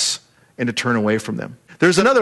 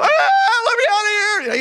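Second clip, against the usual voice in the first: what Daniel did not do in the lions' den, a mock scream. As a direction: fake a panicked cry from the pit. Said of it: "Not crying, not screaming".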